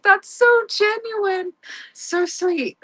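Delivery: high, drawn-out gush; acted coo of admiration; touched gushing. Retelling her touched reaction.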